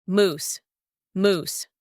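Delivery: "Moose" with a long oo; long vowel. Shown as a demonstration. Teacher giving test answer, long oo in "Moose". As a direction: draw out the oo in "Moose". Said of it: "Long pronunciation here".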